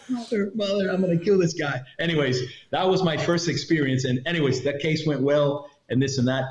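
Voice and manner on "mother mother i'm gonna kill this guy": higher, comic exasperated mutter; fed up with late backup; mock exasperation. Agent fuming about the late agent, comic exasperation. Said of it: "I'm like".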